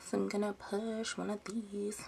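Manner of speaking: ordinary talk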